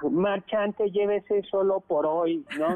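high, hyped street-seller patter; market vendor call. Pretend. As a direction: call out to passing shoppers. Doctor hawks truffles like market vendor.

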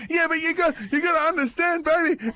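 high, loud mock pleading; hammed-up, high-pitched self-defence; frantic excuse. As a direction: plead your case to your daughter at the top of your voice. Dad's frantic excuse, acted.

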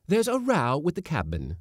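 ordinary delivery, nothing marked

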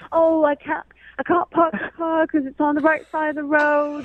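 high, loud put-on British accent; hammed-up British accent to mock his excuse. Woman mimics her date's parking excuse, mock British accent. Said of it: "That's a pretty good accent".

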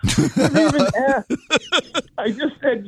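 high, laughing admission. Real, not acted. Guest laughing as he admits he never asked about pay. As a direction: laugh at how naive you were. Laughs at his own naivety.

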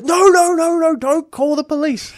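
high, slow panicked pleading; panicked plea. Retold or imitated. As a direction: beg them not to call the police. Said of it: "Alex instantly goes".

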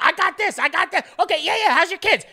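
high, frantic back-and-forth; mock an over-eager friend's catch-up; hyped-up small talk. Mocking talk about nothing with a friend, high hurried chatter. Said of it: "And I'm like".

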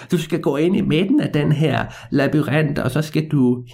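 put-on high, drawn-out old man's voice. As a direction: play the old man slowly handing out a job. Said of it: "den gamle mand, han var sådan".

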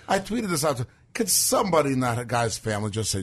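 ordinary delivery

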